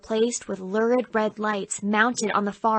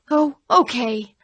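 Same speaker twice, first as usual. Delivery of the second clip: slow, drawn-out surrender; slow hammy giving in; 3 semitones higher, 4 dB louder than usual. Acted. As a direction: give in like a bad church play actor. Boy actor gives in.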